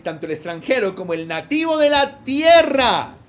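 steady, declaiming Bible reading; scripture reading. Acted. Preacher reading scripture to his congregation, declaiming. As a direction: read the verses out to the church.

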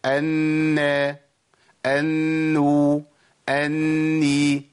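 أن، أنو، أني with the doubled n drawn out in a hum; slow, sustained nasal nun on each form; two-count ghunnah. Shown as a demonstration. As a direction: linger on the n of أني, then open to the vowel. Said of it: "يبقى المشددة أقول إيه؟".

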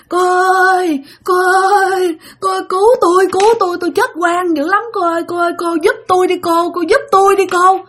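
high, wailing plea of a dead woman; crying ghost woman. Retold or imitated. Old ghost woman begging the girl for help, high and tearful. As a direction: beg through tears like a wronged ghost. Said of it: "là cứ kêu".

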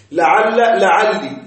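لعل with fatha, then لعلي with kasra, slow; final vowel contrast. Shown as a demonstration. Teacher drilling students on the endings of لعل, slow and clear. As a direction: stretch لعل and لعلي to show the two endings. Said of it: "إثبات اللام الأولى وكسر الثانية".

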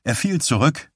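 plain reading voice

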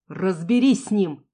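slow, loud furious command; furious order. Acted. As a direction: bark the order in rage. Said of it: "Яростный". (яростный приказ)

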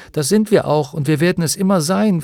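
loud, high, eager assurance; called out. A narrator's reading. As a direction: assure your friend fervently. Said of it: "rief Bastian". (laut und beteuernd gerufen)

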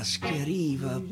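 usual speaking voice

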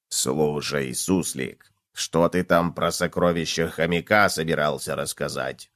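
low, soft character voice; inquisitive cockroach. A narrator's reading. Cockroach asks gopher about treasures, curious. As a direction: probe quietly in a deep pitch.

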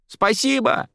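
high, drawn-out call of thanks; elated, high-pitched thanks; called through door. A narrator's reading. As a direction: call it gratefully from another room. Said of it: "донеслось из-за двери".

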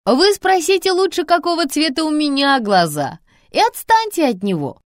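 high, loud call from another room; shouting from the kitchen. A narrator's reading. Mother shouting from the kitchen at the kids. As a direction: yell it across the flat. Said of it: "крикнула мама".

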